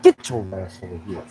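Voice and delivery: quietly menacing coach; mock gruff coach. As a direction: growl it like you're collaring him. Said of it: "just be like".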